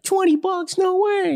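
high-pitched, excited disbelief; mock amazed win. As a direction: squeal it like a small-time winner. Acting out a small slot win.

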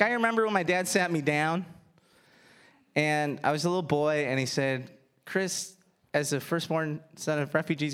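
ordinary talk, nothing marked

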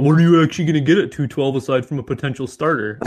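mocking, sing-song SpongeBob meme quote. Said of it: "in the SpongeBob meme voice".

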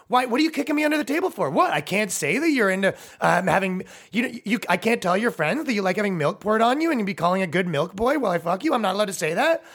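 high, fast mock girlfriend protest. Girlfriend complaining about being kicked under the table, high fast.

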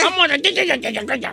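comic mush-mouthed babble; garbled nonsense talk. Pretend. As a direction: run the syllables together till nobody can follow. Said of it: "No se le entiende".

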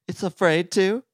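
incredulous Toad; surprised question. A narrator's reading. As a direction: ask it in loud, high disbelief. Toad's surprised question in story.

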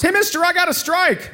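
put-on high, excited kid voice; lightly voiced kid bragging; proud little kid. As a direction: boast like a small boy. Kid boasting to comedian.

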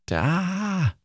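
high, drawn-out "ah"; exclamation-mark cry. Shown as a demonstration. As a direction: sing out a long high exclamation. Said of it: "I don't know how it's pronounced".

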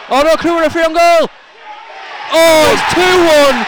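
loud dismayed yell; shout. Real, not acted. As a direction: yell it in horror. Goal conceded, live commentary.

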